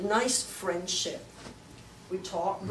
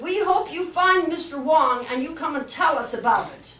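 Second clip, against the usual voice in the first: excited; 7 semitones higher, 11 dB louder than usual. Retold or imitated. Kids quoted, loud high.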